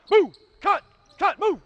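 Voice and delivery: high, loud barked drill calls; yelling commands. Real, not acted. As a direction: yell the moves at the players. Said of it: "And I'm yelling".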